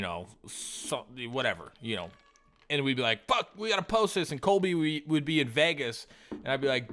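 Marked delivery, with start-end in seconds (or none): 3.3-4.3 much higher, sudden alarm; acted-out jolt of urgency; urgent exclamation. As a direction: blurt it out like a retold emergency. Acts out their urgent reaction.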